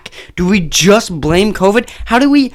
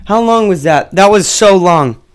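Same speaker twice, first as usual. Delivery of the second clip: normal talking voice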